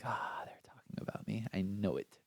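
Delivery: hushed whisper of suspicion; paranoid whisper. Retold or imitated. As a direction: whisper it like a nervous secret. Paranoid inner thought.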